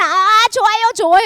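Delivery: high, loud mock crowd delight; eager approval. People hearing the word at first, eagerly delighted. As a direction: cheer it on eagerly.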